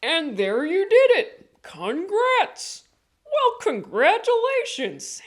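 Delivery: high, loud imitation of the teacher; teacher's praise. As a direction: squeak out the congrats in a mock teacher voice. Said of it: "Joe, he goes".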